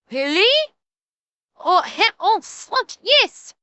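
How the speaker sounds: mock-Japanese accent, delighted; badly faked Japanese accent, climbing to delight; attempted Japanese accent. Japanese diner learning chips are free, put-on Japanese accent. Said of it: "how well those accents came through".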